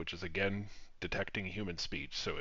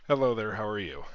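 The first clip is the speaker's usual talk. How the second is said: hello there, said much louder and higher to trigger the mic; raised voice, calling to a voice assistant; louder hello to the bot; 3 semitones higher, 8 dB louder than usual. Real, not acted. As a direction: project the greeting so the assistant picks it up. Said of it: "I need to tweak these thresholds".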